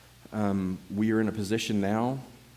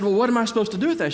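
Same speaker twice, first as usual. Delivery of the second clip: baffled retort; 11 semitones higher, 4 dB louder, much faster than usual. Retold or imitated.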